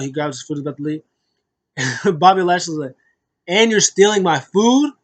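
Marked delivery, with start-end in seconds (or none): 3.5-4.9 loud, raised-pitch incredulous challenge; mock indignant accusation. Wrestler confronting the man taking his plate, mock indignant. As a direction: imitate someone outraged at a food thief. Acting Bobby confronting food thief.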